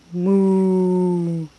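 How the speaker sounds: long, even moo; drawn-out cow sound. Shown as a demonstration. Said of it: "Can you do your cow?".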